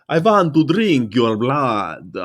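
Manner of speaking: slow, sinister cartoon vampire; vampire impression. Wishing Chip could do the vampire line, slow vampire voice. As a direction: drawl it like a Count. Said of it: "I wish Chip were here. He could do it".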